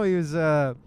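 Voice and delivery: ordinary delivery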